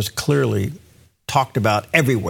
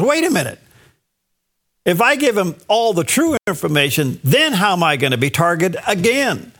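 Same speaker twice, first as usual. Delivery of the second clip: retold alarm; 6 semitones higher than usual. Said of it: "he goes".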